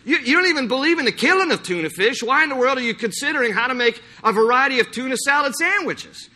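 a hint of a puzzled do-gooder asking absurd questions; mock Greenpeace activist. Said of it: "somebody from Greenpeace saying".

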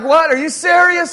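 shocked disbelief. Acted. Churchgoer hearing the pastor's request, high stunned disbelief. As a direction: react like you can't believe it. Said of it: "You'd be like".

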